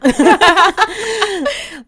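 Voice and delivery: a high, loud burst of laughter; big laugh. Real, not acted. Guest bursting into loud laughter at her own joke. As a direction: let a real laugh spill out at your own joke.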